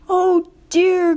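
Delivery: high groaning oh dear; pained engine groan. A narrator's reading. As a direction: groan it out in distress. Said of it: "groaned Thomas".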